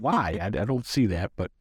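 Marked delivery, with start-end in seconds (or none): 0.0-0.4 puzzled. Retold or imitated. Retold own bafflement.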